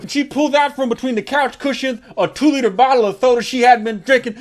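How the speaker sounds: normal talking voice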